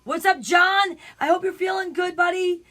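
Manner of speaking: loud, high, upbeat hello; hearty greeting. Real, not acted. Host spotting John among the viewers, hearty shout-out. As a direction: call out cheerfully to a buddy.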